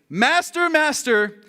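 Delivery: high, acted cry of alarm; panicked cry. Disciples waking their master in a storm, panicked cry.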